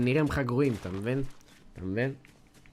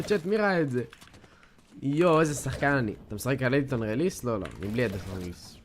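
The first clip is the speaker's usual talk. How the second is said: ordinary talk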